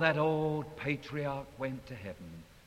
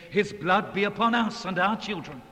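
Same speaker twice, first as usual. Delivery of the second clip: loud, raised crowd cry; crowd clamoring; 5 semitones higher, 8 dB louder than usual. Retold or imitated. Mob before Pilate, loud clamoring. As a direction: shout it up high like the mob. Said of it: "They were saying".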